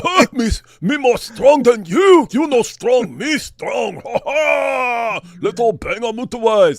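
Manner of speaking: high, loud, slow dumb-brute boasting with shouts; mock caveman brag. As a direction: ham up a chest-thumping caveman bragging. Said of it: "They were listening to".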